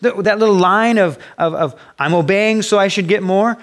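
mock flustered protest, high and loud; confused believer. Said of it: "we get all confused because".

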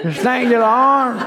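hammed-up Australian accent, pitched high; mock Australian worship leader. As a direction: parody a fake Australian praise leader. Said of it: "trying to sing it like".

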